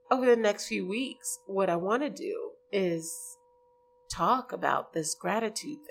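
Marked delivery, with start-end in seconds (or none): none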